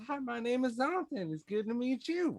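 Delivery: very high, fast little satyr. Acted. Little satyr Zontan greeting everybody, high and fast. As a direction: squeak it out like a small satyr.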